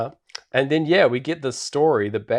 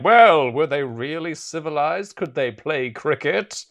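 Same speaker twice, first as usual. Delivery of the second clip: higher-pitched mock guild objector; snooty guild member; 5 semitones higher than usual. Guild member questioning whether the bears are civilized, mock-sceptical. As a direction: play a sceptical old-guard club member, raised pitch. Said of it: "everyone else in the guild is like".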